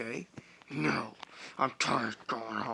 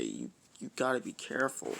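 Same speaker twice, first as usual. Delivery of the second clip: usual speaking voice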